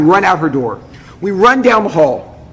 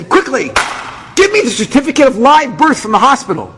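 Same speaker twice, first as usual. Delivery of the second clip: urgent demanding; 6 semitones higher than usual. Retold or imitated. Frantic clerk demanding a document.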